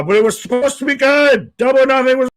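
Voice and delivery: high, loud exasperated wail; frustrated outburst. Real, not acted. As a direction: vent it, voice climbing.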